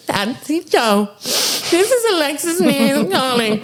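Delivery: high, slow put-on Alexis voice; mock hysterical phone message, high and drawn out; imitating Alexis. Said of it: "and it's like".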